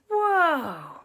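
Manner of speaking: high, loud, falling whoa; drawn-out burst of amazement; amazed whoa. Acted. Onlookers spot someone famous over there, amazed.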